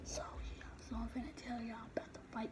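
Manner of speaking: hushed voice to the camera; whispering. Real, not acted. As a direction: whisper it so no one hears. Whispering story opener.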